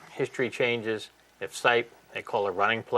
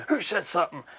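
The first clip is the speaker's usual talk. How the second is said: mock tough-guy challenge; 7 semitones higher, 4 dB quieter than usual. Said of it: "he goes".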